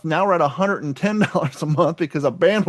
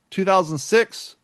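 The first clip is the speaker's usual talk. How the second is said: ordinary talk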